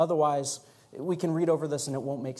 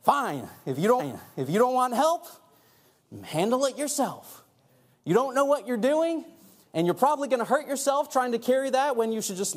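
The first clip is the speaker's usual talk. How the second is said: higher-pitched, acted huffy retort; a little indignant; 8 semitones higher than usual. Acting out a snubbed helper to the congregation, mock indignant. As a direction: throw the offer back, indignant and emphatic. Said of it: "sometimes I get a little indignant".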